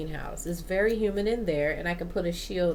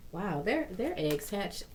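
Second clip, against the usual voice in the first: ordinary talk, nothing marked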